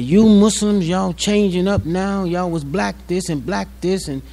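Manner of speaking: imitating a complaining preacher, a touch mocking; mock arguing preacher. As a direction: mimic the preacher railing. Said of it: "and he said".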